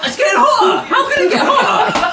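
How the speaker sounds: high, loud laughing panic; pained laughing yell. Real, not acted. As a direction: yelp as the heat builds. Mouth burning from sauce.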